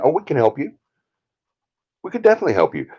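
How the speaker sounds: high, mock-helpful team voice; eager helpers. Team coming in to offer her help, imitated eager helpers. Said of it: "this team coming in and saying".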